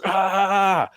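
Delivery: sudden high cry of pain. Retold or imitated. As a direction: cry out as the jolt of pain hits. Waking in pain, electric charge.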